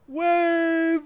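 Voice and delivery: very loud high scream of warning; shouted warning. A narrator's reading. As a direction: bellow it in alarm. Uncle warns of huge wave.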